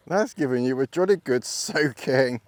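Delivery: ordinary talk, nothing marked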